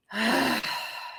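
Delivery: long audible exhale; releasing sigh. Real, not acted. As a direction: let the breath out fully. Exhales after deep breath cue.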